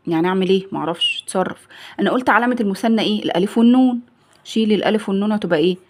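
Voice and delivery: ordinary talk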